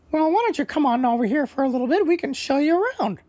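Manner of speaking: high, loud squawky parrot; proud parrot introducing himself, high and loud. Acted. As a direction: chirp it out as a cheerful parrot bird. Parrot invites the boys.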